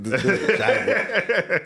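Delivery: laughing through the words. Real, not acted. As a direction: say it while cracking up. Laughing while objecting.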